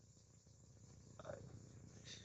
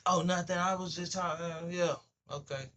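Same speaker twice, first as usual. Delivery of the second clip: high, louder nervous "oh, nothing"; high mock backpedal; 7 semitones higher, 3 dB louder than usual. Caught gossiper backpedalling when called out, high and nervous. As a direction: pitch up and fumble the excuse.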